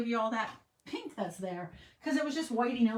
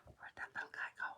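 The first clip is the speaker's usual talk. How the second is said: whisper; 14 dB quieter than usual. Real, not acted. Searching for a stamp piece.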